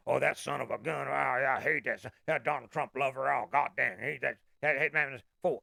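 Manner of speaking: hammed-up grumbling at the other side; imitating a sore driver muttering in his car; mock angry rant. A man fuming in his car after an argument, mock angry. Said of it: "you're like".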